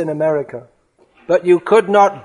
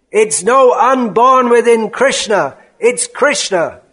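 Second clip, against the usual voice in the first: loud, high, emphatic correction; heated outburst, pitched up; furious roar; 5 semitones higher, 4 dB louder than usual. Acted. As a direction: thunder it at a wayward disciple. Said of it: "he roars".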